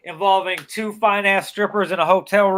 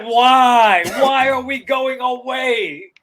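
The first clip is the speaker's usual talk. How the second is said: high, drawn-out complaint; mock protesting wail; 6 semitones higher, much slower than usual. Body part objecting to leaving the party, high mock wail.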